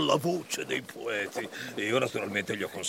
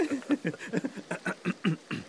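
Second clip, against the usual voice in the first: mocking laugh; 9 semitones higher than usual. [name] laughs after teasing.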